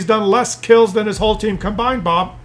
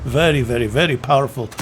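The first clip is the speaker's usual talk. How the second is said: mock Russian accent; 7 semitones lower, 5 dB quieter than usual. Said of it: "and Wargaming said".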